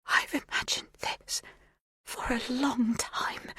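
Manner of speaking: barely audible whisper. A narrator's reading. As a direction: breathe the words so quietly. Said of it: "so quietly I barely catch her words".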